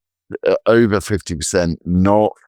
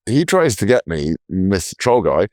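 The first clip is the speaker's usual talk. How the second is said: normal talking voice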